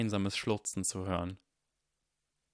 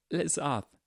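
higher, voice close to cracking; breaking voice; 6 semitones higher than usual. Acted. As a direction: say the name as if about to break. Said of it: "mit berstender Stimme".

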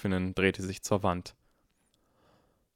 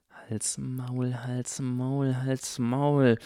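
desperate hushing; 4 semitones higher, much slower than usual. A narrator's reading. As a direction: mutter it insistently, growing firmer. Harry desperate to silence gushing Draco.

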